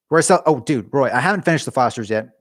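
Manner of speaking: normal talking voice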